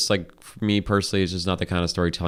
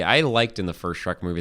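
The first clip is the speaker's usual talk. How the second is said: normal talking voice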